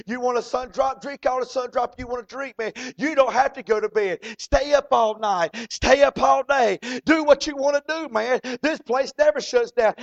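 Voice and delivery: a cabbie pressing the newcomer, building up; cab driver insisting. Acted. Cab driver urging the scared traveler, rising to loud. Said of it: "He said".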